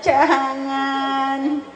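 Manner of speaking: high, long-held dainty protest; gentle mock anger. Teacher translates the child's scolding, imitating gentle drawn-out anger. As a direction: stretch it sweetly, imitating harmless anger. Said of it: "Paling kalau marah".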